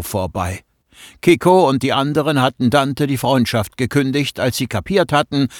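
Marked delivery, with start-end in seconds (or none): none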